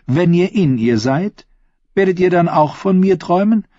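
playful question climbing high; teasing. A narrator's reading. Queen to her new lover, teasing. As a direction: press him coyly, pitch lifted. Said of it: "fragte sie neckisch".

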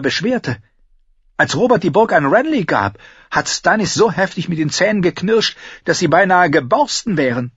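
high, animated character voice; Cersei, gossiping. Acted. Cersei telling her brother of Stannis's grudge, animated. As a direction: recount the grudge with relish.

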